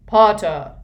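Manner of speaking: loud shout ringing across the hall. Acted. Snape shouts at Harry across the hall, loud. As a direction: bark the name to stop them. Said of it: "The voice rang across the entrance hall".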